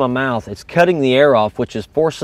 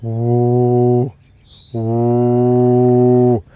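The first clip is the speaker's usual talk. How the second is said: long droning "woo" on one note; sustained deep hum, like blowing into a call; low deep sound. Shown as a demonstration. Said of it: "that low, deep sound that".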